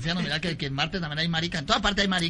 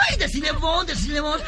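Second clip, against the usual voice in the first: high, urging squeal; playful high-pitched whine; campy coy friend; 8 semitones higher than usual. Acted. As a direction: nudge a friend to speak, hammed up. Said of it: "Le dice el otro".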